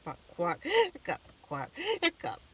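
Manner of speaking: quack, then a high squeaky hiccup; mock duck quack broken by hiccups. Mister Penny trying the butcher's duck cure, quacking. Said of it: "tried quacking like a duck".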